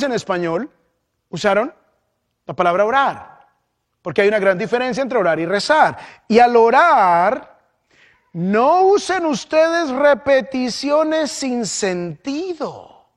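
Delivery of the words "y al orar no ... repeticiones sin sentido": slow, solemn scripture reading; lectura solemne. Acted. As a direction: read the verse slowly and weightily. Lee el versículo solemne. (lectura bíblica lenta y solemne)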